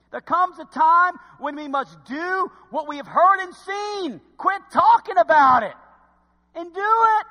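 high, loud, slow preacher's exhortation; preaching at full force. Acted. Preacher urging the congregation to act, full-force shout. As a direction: build to a shouting call to act.

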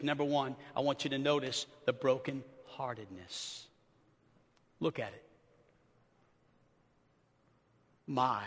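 very soft and low, with long pauses between words; hushed, tender preaching. Acted. Preacher softly voicing a broken father's plea, hushed. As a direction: let each word fall quietly like grief.

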